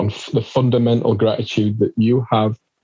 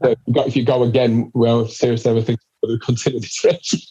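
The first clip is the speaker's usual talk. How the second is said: normal talking voice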